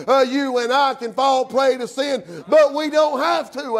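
loud, high, hard-driving sermon; full-force preaching. Real, not acted. Preacher tells hearers they are no Samson, full-force preaching. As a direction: hammer each phrase out to the congregation.

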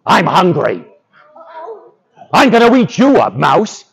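lightly growled hungry lion threat; growling lion. A narrator's reading. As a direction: menace the mouse as a hungry lion. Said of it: "grabbed Mouse by the tail and growled".

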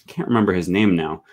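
normal talking voice

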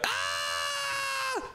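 a high startled scream. Acted. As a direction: shriek in fright. Elf gets angry at burnt chicken.

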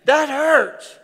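high, loud cry of pain; pained protest. Retold or imitated. Preacher yelping at his therapist in pain. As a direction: yelp at the therapist, it hurts. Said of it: "I'm like".

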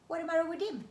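high, loud creature squeak; puzzled squeaky bungee. Acted. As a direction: chirp it like a small curious beast.

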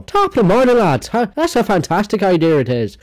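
hammed-up Irish brogue, pitched high; a jokey stage Irish accent; mock Irish accent. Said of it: "My Irish accent's fantastic".

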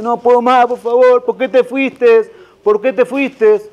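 hammed-up wailing, pitched high and drawn out; mock crying. Man wailing at a grave in a joke, mock sobbing. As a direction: plead like a grieving mourner, slow and high. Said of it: "que estaba llorando".

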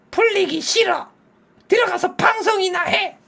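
put-on high childish refusal; sulky kid brushing dad off. Daughter refusing to play with dad, high childish sulk.

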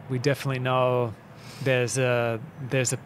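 ordinary delivery

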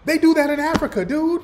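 high, exasperated comeback to the guest's claim; incredulous retort. Real, not acted. Answering the guest on the stream, high and incredulous. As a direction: throw the guest's point back at him in disbelief.

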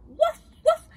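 loud, high woofs; two excited dog barks; dog's bark. Acted. Dog reacts to the smoky house, loud high barks. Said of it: "El perro ladró".

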